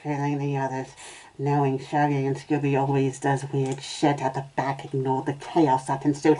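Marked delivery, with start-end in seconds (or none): none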